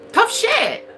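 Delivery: mocking high repeat of tough shit. Shown as a demonstration.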